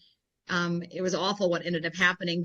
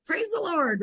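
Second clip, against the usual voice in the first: bright, much higher cheer of praise; joyful exclaim; 9 semitones higher, slower than usual. Retold or imitated. Newscaster cheering a senior fan's news, high and joyful. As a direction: toss it up high with delight. Joyful reply to elderly fan.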